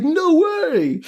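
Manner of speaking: very high hammed-up kid's cry; mock bratty refusal. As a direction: refuse like a defiant brat. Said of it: "he's like".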